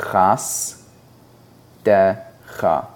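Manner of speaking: chas... decha, split into separate syllables. Shown as a demonstration. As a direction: say "chas" then "decha" with a pause between. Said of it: "So again, that word is".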